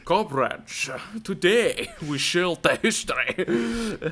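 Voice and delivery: slow, jokey Russian impression with a Scottish accent; Scottish-tinged Russian accent. Joker addressing comrades about the space mission, mock Russian-Scottish accent. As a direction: do a bad Russian orator via Scotland. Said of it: "put on a Scottish accent for my Russian impression".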